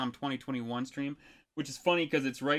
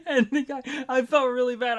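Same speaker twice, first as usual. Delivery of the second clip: still laughing; 9 semitones higher, 6 dB louder than usual. Real, not acted. Still laughing after story.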